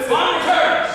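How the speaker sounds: high shouted proclamation; loud announcement. Acted. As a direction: belt out the line. Quoting the laugh line again.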